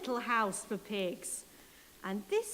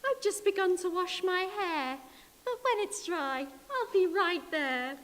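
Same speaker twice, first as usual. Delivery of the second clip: much higher, louder sing-song hello; a prim lady answering the phone; 7 semitones higher, 4 dB louder than usual. Acted. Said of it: "she said".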